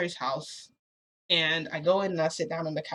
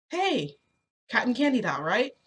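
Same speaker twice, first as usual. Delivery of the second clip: lightly voiced principal; 5 semitones higher than usual. Principal greets her, voiced.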